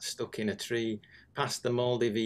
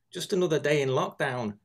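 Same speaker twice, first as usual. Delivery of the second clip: lightly put-on voice of a chatty neighbour, higher and a bit louder; woman neighbour voiced; 5 semitones higher, 4 dB louder than usual. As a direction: toss it off like a neighbour at her gate. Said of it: "says the woman who is hosing".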